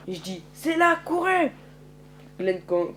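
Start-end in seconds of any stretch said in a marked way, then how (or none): 0.5-1.5 high, slow, drawn-out shout; urgent call. Acted. Leading the group to shelter, calling out high and slow. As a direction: call them to run to the spot.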